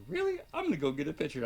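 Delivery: high, loud burst of imitated excitement; mock-eager squeal easing down; retold excited reaction. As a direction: jump way up on the first word, then rush into it. Retelling Sam's excited reaction.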